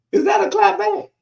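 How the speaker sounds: loud, very high comic query; high-pitched playful challenge; mock-innocent question. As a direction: ask it way up high, teasing the crowd.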